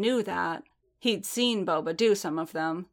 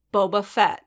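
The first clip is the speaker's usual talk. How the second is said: dangerous tone; 6 dB louder than usual. A narrator's reading. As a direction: say the name as a warning. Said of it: "he repeated dangerously".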